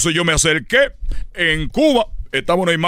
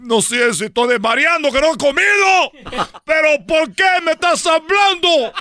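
desconcierto agudo; 11 semitones higher than usual. Acted. Habla asustado con la aparición.

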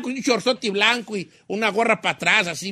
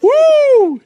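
loud, high "uh" whoop; 8 dB louder than usual. Retold or imitated. Joking over band cries, a loud high whoop. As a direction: let out a band-style whoop.

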